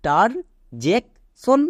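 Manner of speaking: Interjection split into slow separate syllables; teacher spelling out each part. Shown as a demonstration. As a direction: break interjection up, very slowly. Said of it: "এবার একে একসাথে উচ্চারণ করো".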